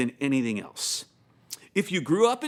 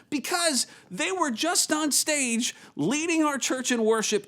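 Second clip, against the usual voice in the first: higher-pitched, emphatic imitation of a complaint; earnest objection; 7 semitones higher than usual. Church elder complaining about the band drinking, mock-earnest objection. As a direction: imitate an elder pressing the point.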